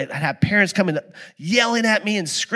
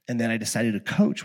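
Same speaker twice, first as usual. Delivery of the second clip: ordinary talk, nothing marked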